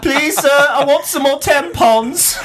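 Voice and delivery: high, slow laughing British orphan; mock Oliver Twist accent. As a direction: plead like a Victorian orphan. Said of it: "sarcastic, weird British and Australian accents".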